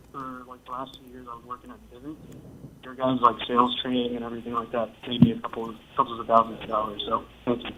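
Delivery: faint voice, phone held far from mouth; speaking away from the phone. Real, not acted. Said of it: "it's hard to hear you, man. Can you talk into the phone?".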